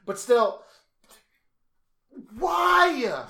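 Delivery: high, loud, drawn-out wail; hammed-up dramatic lament; anguished mock protest. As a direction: wail it slowly in over-the-top outrage. Hammed-up protest about the show.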